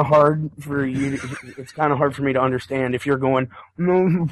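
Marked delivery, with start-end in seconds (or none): 3.8-4.3 muffled "No." as if mouth covered; hand over mouth. Pretend. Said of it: "Do, do a reenactment".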